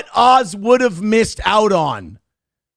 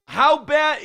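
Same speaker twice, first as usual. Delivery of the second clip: excited shouting; 7 semitones higher than usual. Real, not acted. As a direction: yell with triumphant glee. Celebrating, shouting high.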